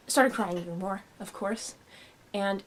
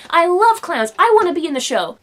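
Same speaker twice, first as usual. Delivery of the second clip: high, loud burst of excitement; excited little kid; 6 semitones higher, 9 dB louder than usual. Retold or imitated. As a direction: gush like a thrilled child. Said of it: "I was so excited".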